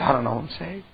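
wife admitting her doubt to her husband; worried confession. Retold or imitated. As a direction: confess it quietly, unsure.

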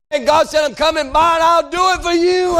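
high, ringing sermon climax; impassioned preaching. Real, not acted. Preacher proclaiming God's promise to the congregation, impassioned. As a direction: lift the promise to a peak.

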